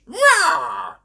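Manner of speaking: high, loud little monster roar; George roaring. A narrator's reading. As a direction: roar like a small child playing monster. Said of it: "said George".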